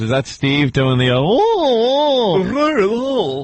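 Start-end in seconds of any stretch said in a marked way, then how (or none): 1.2-3.4 high, drawn-out wacky "oh"; long goofy high oohs; wacky voice wail. Retold or imitated. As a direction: imitate a silly high-pitched wail. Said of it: "wacky voice".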